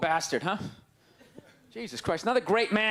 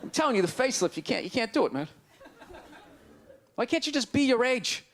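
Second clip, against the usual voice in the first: usual speaking voice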